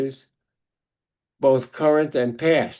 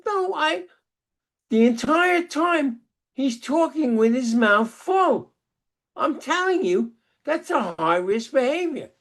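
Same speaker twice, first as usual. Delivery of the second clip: wife's retort; 11 semitones higher than usual. Retold or imitated.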